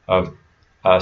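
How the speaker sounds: ordinary talk, nothing marked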